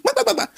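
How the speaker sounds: squeaky imitation of chattering; high mock babble. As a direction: mimic the noise of their quarrelling, high and fast.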